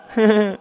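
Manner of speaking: high, loud burst of laughter; wild laugh. Acted. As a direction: bride cackling with glee. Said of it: "一边狂笑".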